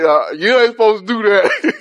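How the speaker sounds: laughing through the words; real laugh breaking in; giggling while correcting himself. Real, not acted. Laughing at his own slip.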